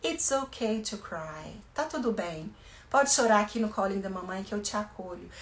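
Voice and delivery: put-on mother soothing her crying child softly; tender comfort. Shown as a demonstration. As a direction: hush a crying child gently. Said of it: "deixa a criança chorar".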